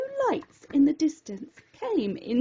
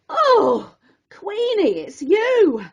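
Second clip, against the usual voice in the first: surprised relief; 5 semitones higher, 5 dB louder, slower than usual. Acted. Relief after a fright.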